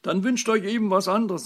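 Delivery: loud, high, exasperated plea; pleading. A narrator's reading. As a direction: urge them, at your wits' end. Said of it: "sagte die Fee händeringend".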